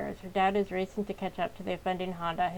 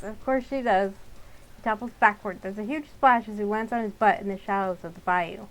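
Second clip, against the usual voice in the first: usual speaking voice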